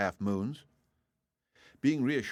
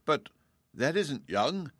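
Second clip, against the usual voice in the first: amazed; 6 semitones higher, slower than usual. A narrator's reading. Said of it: "cried Dorothy in amazement".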